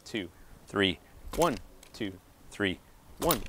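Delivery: counting one two three in time with each hit; rhythmic counting with the hit falling on three. Shown as a demonstration. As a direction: call out the beats, letting three land on the hit. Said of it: "try and hit on three".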